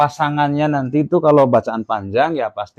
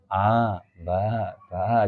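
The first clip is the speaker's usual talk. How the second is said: put-on drawn-out "a, ba, ba", like children reading; meniru bacaan anak-anak; 4 semitones lower, 6 dB quieter, much slower than usual. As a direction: stretch each of "a, ba, ba" too long. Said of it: "kalau anak-anak ya, itu ada yang". (a, ba, ba dipanjangkan)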